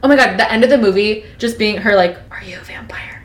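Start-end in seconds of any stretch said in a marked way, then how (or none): 2.3-3.2 whisper. Pretend. Bella's hushed question to Edward.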